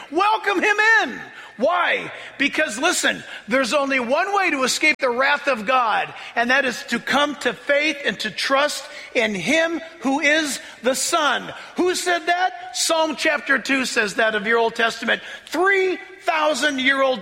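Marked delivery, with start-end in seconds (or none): 0.1-1.2 full-voiced preacher's shout. Real, not acted. Preaching climax. 10.2-12.7 preaching shout. Real, not acted. Preaching climax.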